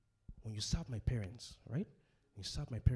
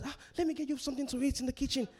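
fast, higher polite mother voice; mother-in-law offer; 14 semitones higher, 5 dB louder, much faster than usual. Retold or imitated. Preacher imitates a mother-in-law offering food, quick and higher. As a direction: rattle off a hostess offer. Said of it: "She says".

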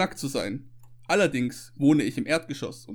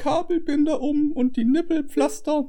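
high put-on woman's voice; 12 semitones higher than usual. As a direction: play the indignant woman. Playing Janina in the skit.

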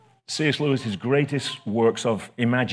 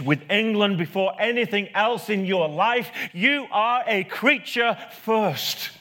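building preaching; 6 semitones higher than usual. Acted. Pastor driving home to the congregation that we are creatures first, rising fervent preaching. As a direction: pitch climbing higher line by line, insistent and emphatic.

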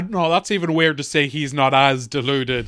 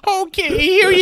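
much higher, meek mock voice; pathetic groom; 13 semitones higher than usual. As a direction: squeak it out meekly. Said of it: "groom hands over money".

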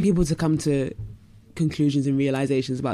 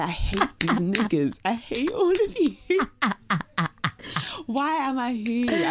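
mock hate; 7 semitones higher, slower than usual. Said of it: "looking around like".

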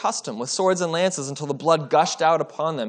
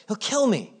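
higher, pleading cry on kill; despairing fear; 7 semitones higher than usual. Acted. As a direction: let the fear break through on kill. Obadiah repeats his fear.

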